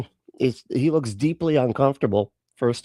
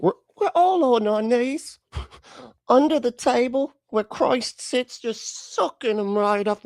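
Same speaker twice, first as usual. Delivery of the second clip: mocking echo; 11 semitones higher than usual. Mocking the speaker's metaphor.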